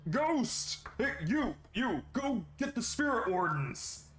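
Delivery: high, shrill-free panicked yelling; panicked guard. Acted. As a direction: scream for the wardens in terror.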